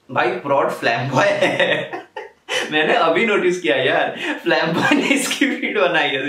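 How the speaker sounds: amused, talking through real laughter; laughing while talking. Real, not acted. Reviewer realizing the beat's producer, laughing through words. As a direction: laugh out the surprise of noticing it.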